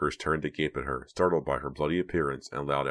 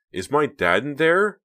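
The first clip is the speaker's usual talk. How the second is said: much higher, louder, slow breathless ask; 11 semitones higher, 8 dB louder, slower than usual. A narrator's reading. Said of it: "Reva asked breathlessly".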